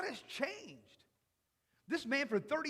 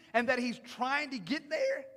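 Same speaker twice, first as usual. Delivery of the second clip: ordinary delivery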